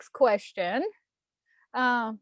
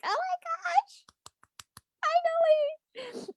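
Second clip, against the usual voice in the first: very high, slow, stretched squeal of joy; giddy squeal; slower than usual. Retold or imitated. Vlogger imagining the first woman VP, squealing giddily. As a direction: float it up high in giddy relief. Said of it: "'cause I'm like".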